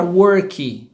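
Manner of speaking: "work" with an added final "ee", like "worky"; Brazilian learner pronunciation. Shown as a demonstration. Teacher mimicking a common Brazilian mistake, "work" as "worky". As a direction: stretch "work" out and tack an i vowel on the end. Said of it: "comum do brasileiro falar".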